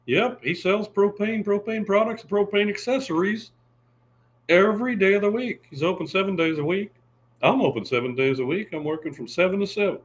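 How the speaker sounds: high Texan propane salesman, calmer; Hank Hill voice. Retold or imitated. Continues Hank voice.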